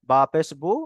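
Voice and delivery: usual speaking voice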